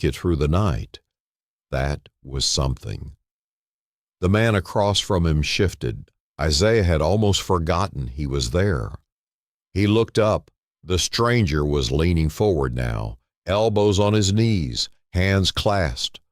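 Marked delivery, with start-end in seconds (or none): none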